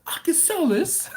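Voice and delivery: very high-pitched thrilled impression; excited coworker. Coworker seeing a business in the sauce, excited impression. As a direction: play him bursting with excitement. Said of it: "He's like".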